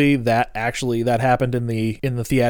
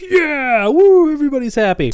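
high, whooping celebration; mock cheering; 12 semitones higher, 4 dB louder than usual. Host imitates Ash celebrating victory, high whooping cheer. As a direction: cheer as if the battle is won. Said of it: "Ash cheers. He's like".

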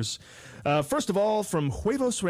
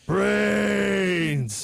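zombie shout. Acted. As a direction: bellow it like a starving zombie. Zombie answers question.

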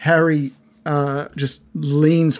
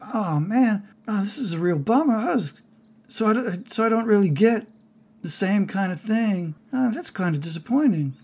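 lightly voiced higher, mildly bummed character; a touch of disappointment, pitched up; Harry, let down; 5 semitones higher than usual. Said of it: "when he goes".

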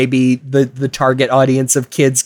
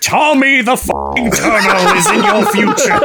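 high, cracking-up mock prophecy; laughing; 13 semitones higher than usual. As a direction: blurt the prophecy while laughing. Laughing spooky prophecy joke.

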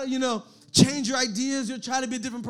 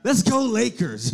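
expressive cheer; 6 dB louder than usual. Retold or imitated. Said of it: "Or kinda be super expressive?".

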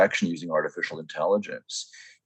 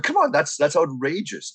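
exasperated; 8 semitones higher than usual. Retold or imitated. Griping about sprayer costs.

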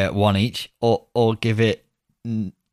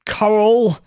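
surprised; 10 semitones higher, 5 dB louder than usual. Real, not acted. Puzzled echo of the clue.

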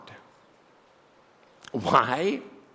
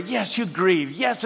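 usual speaking voice

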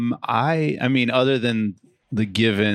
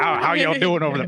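dog voiced in a Southern country accent, pitched up; 5 semitones higher, faster than usual. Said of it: "he talks in a country accent".

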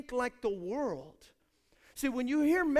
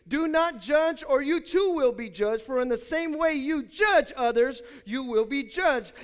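emphatic scripture; 4 semitones higher, 8 dB louder than usual. Acted. Preaching scripture.

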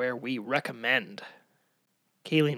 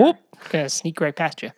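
put-on American accent; 3 semitones higher, 7 dB louder than usual. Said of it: "now I talk like an American".

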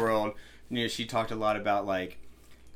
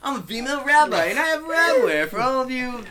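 high, loud put-on sales voice; hammed-up promo in a raised voice; mock advert pitch; 12 semitones higher, 5 dB louder than usual. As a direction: imitate a perky advert spokeswoman.

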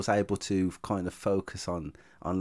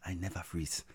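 put-on soft African accent; African businessman; 2 semitones lower, 10 dB quieter than usual. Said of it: "like an African businessman".

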